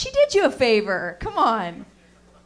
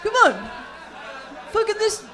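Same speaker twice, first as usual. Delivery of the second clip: loud, higher bro heckle; rowdy audience guy; 6 semitones higher, 6 dB louder than usual. Retold or imitated. Audience guy cheering on cheaters, loud high bro voice. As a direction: hype the crowd like a rowdy bro. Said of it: "this guy over here's like".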